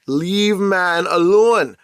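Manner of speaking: drawn out, slow and pointed; slow, weary warning; slow emphatic demand. Acted. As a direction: spell it out slowly, fed up. Emphatic plea to stop.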